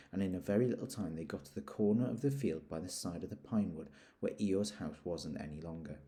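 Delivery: plain reading voice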